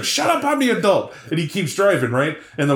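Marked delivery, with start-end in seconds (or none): 0.0-1.1 much higher mock snap; snapping dad. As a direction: shut the kid down. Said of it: "There's the counter influence, like".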